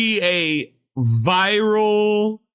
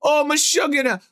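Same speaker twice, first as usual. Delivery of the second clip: high-pitched complaining grandma impression; 7 semitones higher than usual.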